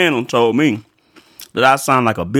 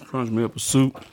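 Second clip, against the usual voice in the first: ordinary delivery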